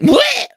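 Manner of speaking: high, loud choking squawk; a bird keeling over with a squawk; high strangled bleh; parrot collapse squawk. Acted. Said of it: "the parrot went".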